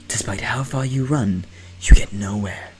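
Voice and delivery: ordinary talk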